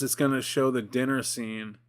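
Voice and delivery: ordinary delivery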